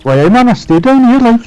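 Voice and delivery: broad Geordie voice; Geordie accent. Acted. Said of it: "you did a Mean Geordie accent".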